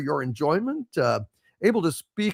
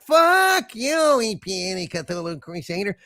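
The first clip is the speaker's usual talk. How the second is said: puppet voice; 4 semitones higher than usual. Acted. Puppet Harvey insults viewer.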